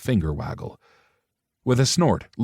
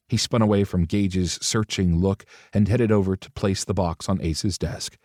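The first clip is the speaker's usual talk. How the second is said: usual speaking voice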